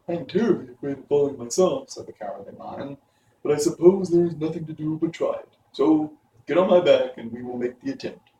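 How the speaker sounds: lightly voiced timid lion, a bit fast; fearful lion. A narrator's reading. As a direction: admit the fear but push on. Lion voice, afraid.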